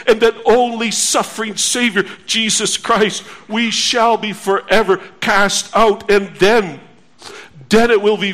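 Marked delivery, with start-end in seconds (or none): none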